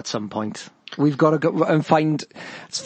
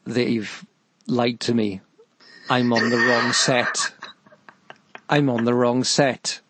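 imagined inner thought; 4 semitones lower, slower than usual. Retold or imitated. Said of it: "she's thinking".

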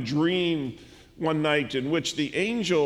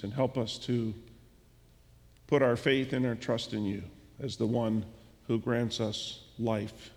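closing prayer; 4 semitones lower, 4 dB quieter than usual. Real, not acted. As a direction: pray quietly to close the service.

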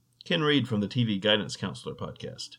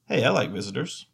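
normal talking voice

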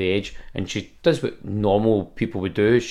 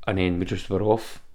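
ordinary talk, nothing marked

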